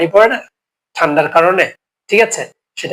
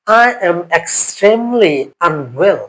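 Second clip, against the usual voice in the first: slow model phrase; much slower than usual. Shown as a demonstration. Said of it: "teacher models advanced phrase".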